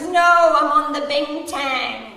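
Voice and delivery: put-on high, loud, slow drunken boast with a laugh; drunk son. Son on the phone, mock drunken slur. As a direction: sound merrily sloshed. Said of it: "He was pissed, absolutely pissed".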